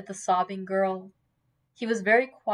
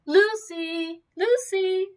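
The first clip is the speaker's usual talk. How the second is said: high, sung-out name call; high-pitched summons; calling from afar; 10 semitones higher, 4 dB louder, slower than usual. A narrator's reading. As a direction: call out to someone from a carriage. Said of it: "came voices from the carriage".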